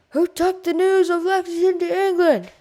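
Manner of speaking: loud high shouted question; crowd yelling. A narrator's reading. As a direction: call it out over the crowd. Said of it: "the crowd yelled".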